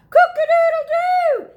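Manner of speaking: loud high crowing; rooster crow. Acted. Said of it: "who cried".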